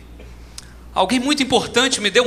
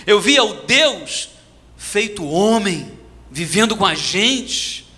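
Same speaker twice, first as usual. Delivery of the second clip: ordinary delivery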